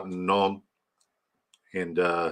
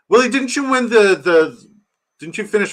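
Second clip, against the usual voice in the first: excited question; 11 semitones higher, 7 dB louder than usual. Real, not acted. Excited question to a chat viewer.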